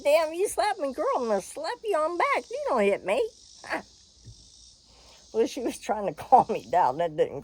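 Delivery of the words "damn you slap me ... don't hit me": playful mock threat. Retold playful threat to Lisa.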